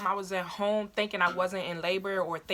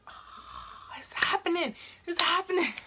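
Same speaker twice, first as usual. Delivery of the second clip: breath in, then high-pitched squeal; retold excitement; 10 semitones higher, slower than usual. She and mom realize it's happening, high excited re-enactment. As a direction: squeal it in mock amazement. Mother and daughter realize labor is real.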